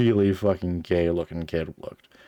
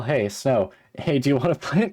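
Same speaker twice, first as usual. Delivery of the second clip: playful character greeting; 6 semitones higher, 3 dB louder than usual. Acted.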